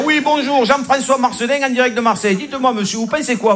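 high, bright reporter patter. Retold or imitated. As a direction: play the eager TV reporter. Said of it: "le mec là". (voix aiguë de journaliste télé en direct)

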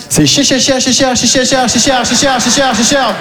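cher repeated, stuck on the word; mock stammer. Bloque sur le mot cher. (bégaiement parodique sur cher)

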